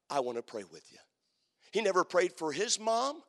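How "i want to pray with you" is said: low, soft, gentle invite; a hint of the tender classmate; soft quoted invitation. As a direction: offer it quietly, like a friend. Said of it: "he would say".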